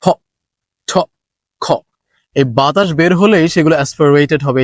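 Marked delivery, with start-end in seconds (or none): 0.0-1.7 ফ, ঠ, খ each with a puff of air you feel on the hand; aspirated sounds. Shown as a demonstration. Teacher has students feel the air on their hand, breathy. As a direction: blow air on ফ, ঠ and খ against the palm. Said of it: "যদি একটু হাত দিয়ে দেখি".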